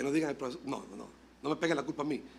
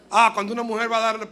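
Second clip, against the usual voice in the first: usual speaking voice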